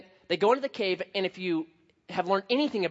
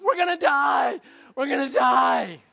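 high, loud mock wail, slow at the end; imitating a terrified teen breaking down; panicked wail; 7 semitones higher, 6 dB louder, slower than usual. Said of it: "just sobbing".

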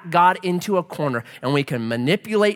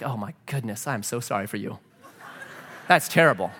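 hushed, lower mock sympathy; soft, lower mock condolence; mock pity; 6 semitones lower, 4 dB quieter than usual. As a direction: imitate a pitying friend, softly. Mock sympathetic friend quote.

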